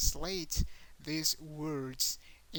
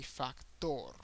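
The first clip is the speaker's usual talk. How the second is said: "factor" as fac-TOR, Spanish style; Spanish "factor", final syllable stressed; stress on last syllable; 2 semitones lower, 3 dB quieter than usual. Shown as a demonstration. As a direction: land on TOR in "factor", falling. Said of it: "the last syllable".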